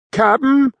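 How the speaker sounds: called high across the deck; a lad calling up to his captain, high and carrying. Acted. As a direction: hail the captain from afar. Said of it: "Elpidio called".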